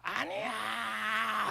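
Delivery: a long, drawn-out, slow "아니야!" yelled at the hall; over-the-top shout. Retold or imitated. Preacher at a youth retreat hamming a long drawn-out shout. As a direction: ham up the denial so the back row hears. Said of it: "좀 오버해서 애들한테 그랬어요".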